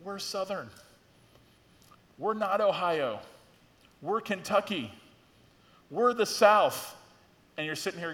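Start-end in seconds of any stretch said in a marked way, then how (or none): 0.0-7.0 proud Southern boast. Retold or imitated. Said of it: "they want to say to you".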